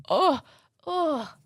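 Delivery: high, drawn-out longing moan; two high sighing cries of nostalgic delight; swooning "oh". Real, not acted. Swoons over remembered doll feature.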